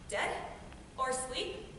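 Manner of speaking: ordinary talk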